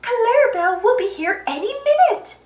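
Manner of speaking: high, fretting cartoon voice; acted high-pitched worry; dismayed cry. A narrator's reading. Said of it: "cries Daisy".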